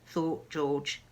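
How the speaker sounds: usual speaking voice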